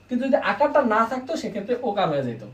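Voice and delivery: usual speaking voice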